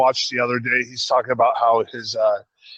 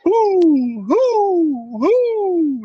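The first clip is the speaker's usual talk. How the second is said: high hooting like an owl; owl hoots; 4 dB louder, much slower than usual. Retold or imitated. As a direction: hoot high and a bit louder. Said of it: "the dad's on the back porch going".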